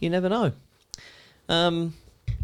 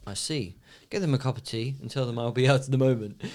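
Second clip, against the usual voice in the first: lower, softer, composed boss; calm boss reply; 3 semitones lower, 5 dB quieter than usual. Retold or imitated. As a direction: stay unnervingly calm. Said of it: "boss reply".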